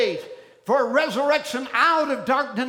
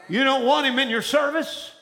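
ordinary talk, nothing marked

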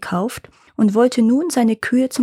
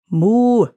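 louder imitated moo; cow moo; 5 dB louder than usual. A narrator's reading. Cows answer the impatient farmer, mooing. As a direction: answer with a drawn cow's moo. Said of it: "antworteten die Kühe".

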